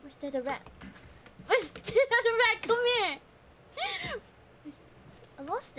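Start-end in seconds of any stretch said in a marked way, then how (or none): 1.5-3.2 excited call. Real, not acted. Chasing rat, calling out.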